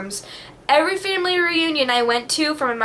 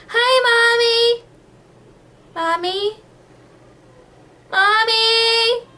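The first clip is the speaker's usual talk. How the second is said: put-on very high, slow, sing-song child; little kid voice; 11 semitones higher, much slower than usual. Imitating her child self chatting to mom on a toy phone. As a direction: chirp it like a tiny girl on a toy phone.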